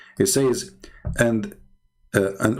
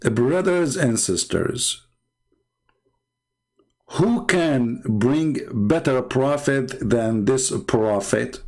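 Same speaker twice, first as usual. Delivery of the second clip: sarcastic; 4 semitones higher, much slower than usual. Acted. Sarcastic preaching appeal.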